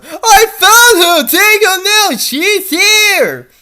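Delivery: high, loud, different-voiced cries of discovery; triumphant shouting. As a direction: yell it to the others, slowly on the first. Captors find Dee Dee.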